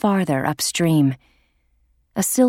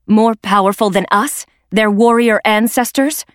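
louder and higher, outraged; rising indignation over the ancestors; indignant challenge; 5 semitones higher, 5 dB louder than usual. Acted. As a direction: throw the question back at them, higher and louder. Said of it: "Blue Star challenged".

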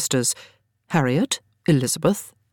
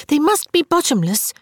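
put-on high, insistent girlish excitement; breathless; 11 semitones higher than usual. A narrator's reading. Little Frances presses her detail on Harriet, breathless. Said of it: "Frances put in breathlessly".

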